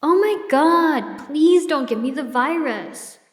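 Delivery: high, slow, panicky whine; whiny near-yell. Retold or imitated. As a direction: shrink back and whine it out high. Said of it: "She jumped back and almost yelled".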